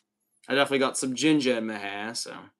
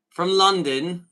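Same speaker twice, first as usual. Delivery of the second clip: put-on a light British accent on London; saying it the British way, lightly; 4 semitones higher, 4 dB louder, slower than usual. Streamer trying a British accent for a viewer. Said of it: "do I do a good, like, British accent?".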